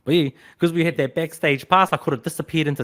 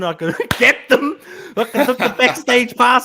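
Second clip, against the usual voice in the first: laughing; 6 semitones higher, 3 dB louder than usual. Real, not acted. Laughs telling sneaky story.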